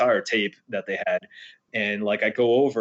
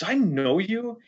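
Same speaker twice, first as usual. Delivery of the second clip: higher mock Jay; 10 semitones higher, much slower than usual. Jay recognising him in hallway.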